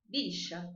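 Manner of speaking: "bicha" with a B and a SH for the double L; Argentine sh for LL. Shown as a demonstration. Teacher demonstrating the Argentine LL sound. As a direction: say villa as "bicha", LL turned to sh. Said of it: "o dois L's pronuncia como sh".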